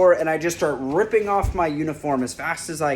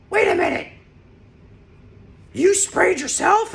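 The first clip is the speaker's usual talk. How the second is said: wait, you did what, retold in a much higher voice; mock disbelief; 4 dB louder than usual. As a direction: pitch it way up in mock shock. Coworkers stunned by self-spray.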